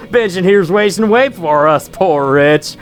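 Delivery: lively hammed-up Australian bug villager; chatty rustic in an amateur Australian accent; bad Australian accent. Villager explaining roach-eating to the visitor, in a bad Australian accent. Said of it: "my Australian accents aren't very good".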